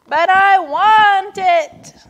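high, loud, drawn-out screech. A narrator's reading. Turtle protesting to Walrus, screeching. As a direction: screech it like a tantrum. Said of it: "screeched Turtle".

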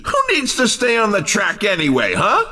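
ordinary delivery

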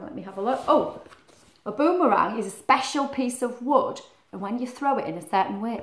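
ordinary delivery

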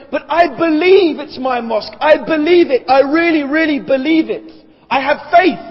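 protesting loudly, as if conviction were proof; insistent believer. Acted. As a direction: plead the claim with rising force. Acting an insistent believer's claim.

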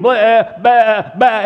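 sheep bleating. Acted. One sheep starting off the flock, bleating. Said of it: "one will start bleating".

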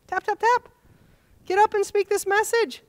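high, insistent voice from above; Holy Spirit's voice. Retold or imitated.

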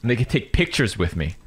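ordinary talk, nothing marked

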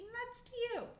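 high squirrel voice; high squirrel greeting; Charette's voice. Acted. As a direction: say it high and friendly.

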